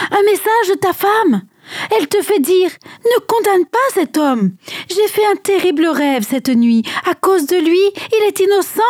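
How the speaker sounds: high-pitched, insistent warning; urgent message. A narrator's reading. Servant runs in with urgent warning. (message urgent, voix haute)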